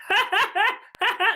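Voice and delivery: high hammed-up ha ha laugh; mock laughter. Friends laughing at their joke, high mock laugh. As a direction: laugh loudly at your own joke. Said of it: "But they're like".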